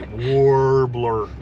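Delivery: warbler, stretched slow and loud on "warr"; laboured, overdone R. Shown as a demonstration. Husband showing what an effort warbler is, laboured R. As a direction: heave out a long R in "warbler". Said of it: "It sounds like an awful effort".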